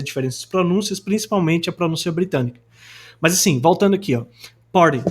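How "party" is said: punchy American "party", R articulated, T like D; American accent. Shown as a demonstration. Teacher returning to the example, American accent. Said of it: "No sotaque americano tem essa articulação".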